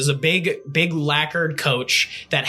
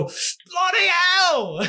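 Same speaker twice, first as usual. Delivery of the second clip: very high, slow drawn-out cry of pain; a Brit run over by a carriage, screaming; pained British yell; much slower than usual. Acted. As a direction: howl it as your leg gets crushed. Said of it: "you hear as they go".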